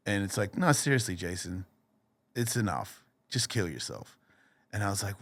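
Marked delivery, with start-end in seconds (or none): 0.6-4.2 soft, understated creepy coaxing; quietly press, sinking low at the end; scary inner voice. Retold or imitated. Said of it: "scary voice that I'm hearing".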